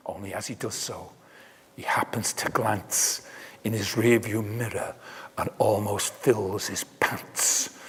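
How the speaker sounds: dropped to a near whisper, fast; hushed suspense. A narrator's reading. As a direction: lean in and hush the dread. Sudden ominous turn in story.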